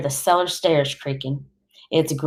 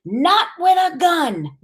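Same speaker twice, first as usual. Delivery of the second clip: high, drawn-out protest; upset grandmother's high, slow yell; 14 semitones higher, slower than usual. A narrator's reading. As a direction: cry out in outraged refusal. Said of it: "Graham yells".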